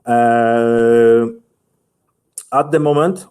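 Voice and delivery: normal talking voice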